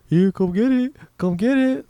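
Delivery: mimicked come-and-get-it holler; imitated taunting call. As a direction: call out as if luring someone over. Imitating man luring with watermelon.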